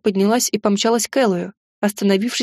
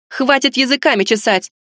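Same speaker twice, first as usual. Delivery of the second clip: sharp shout; 3 semitones higher than usual. A narrator's reading. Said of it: "Хлёсткий окрик Диесы".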